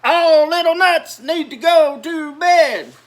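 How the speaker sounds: mother getting mad, calling them to bed; bigger mama voice. A narrator's reading. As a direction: yell it like an annoyed mom. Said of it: "in her bigger voice".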